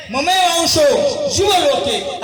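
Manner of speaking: slow, drawn-out chanted Sanskrit; declaimed verse. Acted. Saint declaims the Gita line to the crowd. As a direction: stretch each syllable like scripture.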